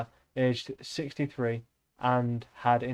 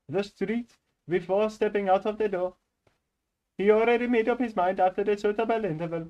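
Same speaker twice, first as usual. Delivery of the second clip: put-on higher-pitched Indian-accented reading; 4 semitones higher than usual. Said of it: "you picked Indian for that reason".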